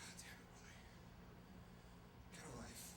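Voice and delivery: hushed, near-whispered exasperation; very soft, weary surrender; resigned giving-in. Retold or imitated. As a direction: sigh it out softly, defeated. Gives in wearily to Willie.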